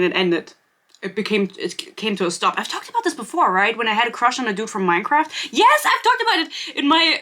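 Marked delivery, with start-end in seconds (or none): 5.5-7.2 high-pitched burst of remembering; excited recollection. Real, not acted. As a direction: jump on it, pitched way up. Suddenly remembers where she told it.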